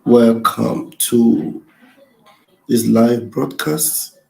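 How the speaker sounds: loud, drawn-out broadcast opener; slow announcer. Acted. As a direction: stretch every word out loud. Host opens live broadcast slowly.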